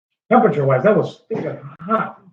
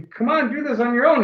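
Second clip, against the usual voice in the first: coaxing parent; 9 semitones higher than usual. Retold or imitated. Voicing parent pushing older son.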